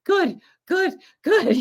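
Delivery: a hammed-up exhausted runner saying good; imitating the grateful runner. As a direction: play the dazed runner muttering thanks up high. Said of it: "He was like".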